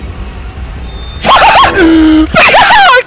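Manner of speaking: high shrieking laughter. Real, not acted. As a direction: burst out in a shrill laugh. Burst of laughter at game.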